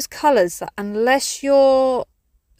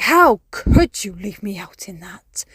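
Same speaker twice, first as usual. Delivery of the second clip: mock cat complaint; 4 dB louder, slower than usual. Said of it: "they scream at me in the morning".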